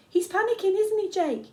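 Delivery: high, loud excited question; anxious shout. A narrator's reading. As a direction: fret loudly to the leader.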